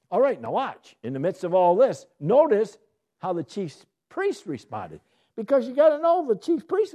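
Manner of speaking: loud, high preacher's build; preaching at full force. Acted. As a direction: rouse the room to listen.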